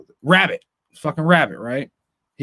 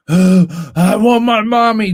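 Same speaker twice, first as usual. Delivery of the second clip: put-on high, slow, louder whimpering child voice; mock crying kid; 5 semitones higher, 7 dB louder, much slower than usual. Mocking his son crying to the listener, drawn-out whine. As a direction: wail it out like a crying five-year-old. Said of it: "The tears, the waterworks".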